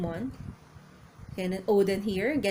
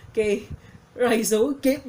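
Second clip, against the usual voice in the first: ordinary talk, nothing marked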